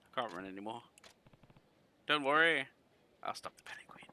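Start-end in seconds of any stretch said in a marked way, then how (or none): 2.1-2.7 raised, higher reassurance; louder reassurance. Acted. 3.2-4.1 soft whisper. Acted. Whispered promise.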